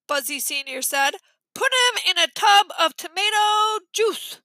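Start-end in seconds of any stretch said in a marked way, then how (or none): 1.5-4.4 high congested instruction; stuffed-nose voice. A narrator's reading. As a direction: give orders through a pinched nose. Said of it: "they had the worst colds".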